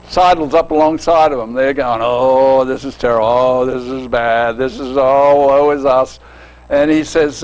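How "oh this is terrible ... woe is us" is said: low, moaning, hammed-up woe; mock lament. Disciples bemoaning to the stranger, mock mournful moan. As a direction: groan it out like gloomy disciples.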